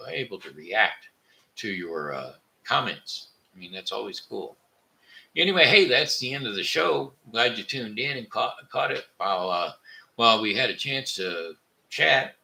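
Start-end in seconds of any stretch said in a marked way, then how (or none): 5.4-7.1 loud, high show closing; bright announcer sign-off; upbeat sign-off. Real, not acted. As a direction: wrap the show with a bang.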